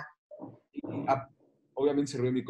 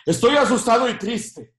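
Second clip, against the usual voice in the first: laughing confession; 8 semitones higher, 8 dB louder than usual. Retold or imitated. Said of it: "cuando terminé dije".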